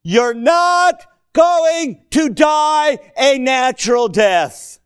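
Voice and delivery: loud, slow preacher's cry; preaching at full force. Acted. Preacher booming Jesus' words to Peter. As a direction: thunder the prophecy at Peter.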